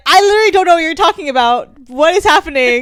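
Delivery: high, fast, baffled squeal; baffled confusion. Retold or imitated. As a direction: act totally lost and confused. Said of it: "And she was like".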